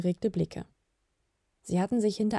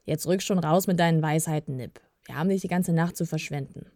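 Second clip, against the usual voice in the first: ordinary delivery, nothing marked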